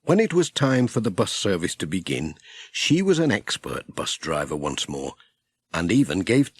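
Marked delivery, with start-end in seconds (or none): none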